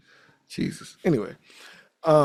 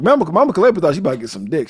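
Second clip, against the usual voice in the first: usual speaking voice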